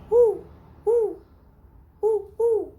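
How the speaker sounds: high loud hooting woo; owl hoot. Shown as a demonstration. Teacher hooting like an owl for the class. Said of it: "Can you do it".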